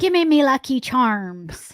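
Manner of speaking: slow mock Irish brogue; Irish leprechaun, slowly; Irish accent. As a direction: lean into a cereal ad leprechaun. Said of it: "Try again".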